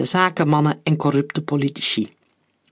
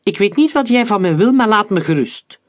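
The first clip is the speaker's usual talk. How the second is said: higher, louder irritated brush-off; 5 semitones higher, 5 dB louder than usual. A narrator's reading. Hector tells the stranger to leave him alone, irritated. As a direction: snap it with raised pitch and volume. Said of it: "Geïrriteerd".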